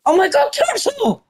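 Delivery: high, delighted outburst; thrilled exclamation. Real, not acted. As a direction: burst out at the surprise find. Spots a turtle, thrilled.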